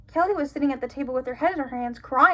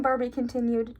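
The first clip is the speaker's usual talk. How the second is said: ordinary talk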